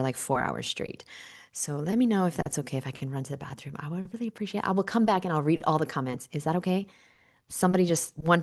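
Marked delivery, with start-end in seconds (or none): none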